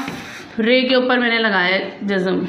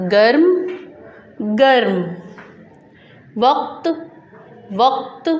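isolated words read out clearly with a pause after each; reading drill. Shown as a demonstration. Teacher leads children through jazm words, measured drill reading. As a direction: model each word for pupils to repeat. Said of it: "आपको भी reading करवा देती हूँ".

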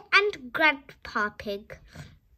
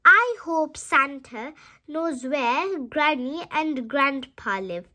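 ordinary delivery, nothing marked

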